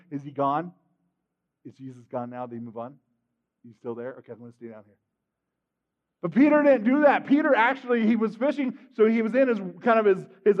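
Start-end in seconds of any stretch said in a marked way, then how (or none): 0.1-4.9 very soft, low, pretend hiding voice; furtive hiding. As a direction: speak low so nobody finds you. Acts hiding under the nets.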